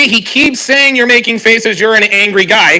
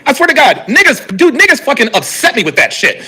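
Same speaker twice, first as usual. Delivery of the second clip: usual speaking voice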